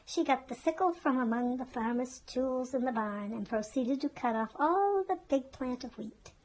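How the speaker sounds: ordinary delivery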